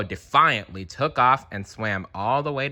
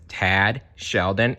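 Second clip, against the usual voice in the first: plain reading voice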